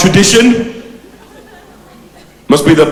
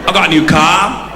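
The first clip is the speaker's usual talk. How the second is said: Brixton geezer character; 4 semitones higher than usual. Acted. Devil Wilkins addressing the audience, in a brash Brixton character voice.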